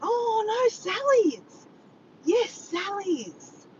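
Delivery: high, drawn-out exclamation; excited correction. Real, not acted. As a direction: call it out high as memory clicks. Corrects herself on remembering.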